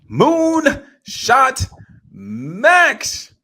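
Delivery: loud, high, drawn-out announcer-style shout; hyped call-out. Real, not acted. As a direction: belt out the name like a ring announcer.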